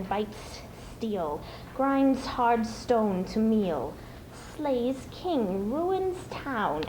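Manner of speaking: slow, creeping and sinister; menacing Gollum riddle. Acted.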